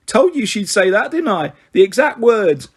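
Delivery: put-on a boy gloating that he called it; triumphant. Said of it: "he said triumphantly".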